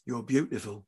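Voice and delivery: soft, hushed admission; whispered. A narrator's reading. Father to his daughter after long silence, whispered. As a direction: say it softly, almost whispering. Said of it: "Fred whispered".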